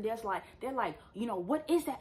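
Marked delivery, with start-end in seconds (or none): 1.3-2.0 high-pitched choking passenger imitation; mock choking. Imitating bus passengers hit by mace, mock choking. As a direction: act out a passenger choking on spray. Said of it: "Everybody's choking and coughing".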